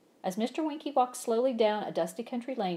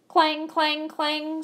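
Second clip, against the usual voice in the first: high loud clang of a trolley; a trolley bell clanging high and loud; screeching trolley bell; 9 semitones higher, 8 dB louder, much slower than usual. A narrator's reading. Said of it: "screeched a trolley car".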